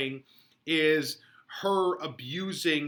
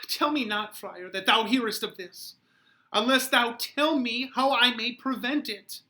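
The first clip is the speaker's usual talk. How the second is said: Juliet crying out high and loud in despair; 9 semitones higher, 6 dB louder than usual. Acted. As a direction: fall apart and beg for pity. Juliet pleads with the friar.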